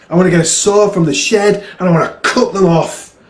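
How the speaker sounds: frustrated outburst. Acted. A son tells his dad he hates his legs, frustrated outburst.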